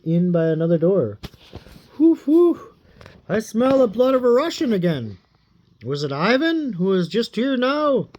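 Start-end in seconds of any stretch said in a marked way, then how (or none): 2.0-8.1 a villain who smells a human; sniffing ogre voice. Acted. Koshchei sniffing the air for Ivan's blood, high and menacing.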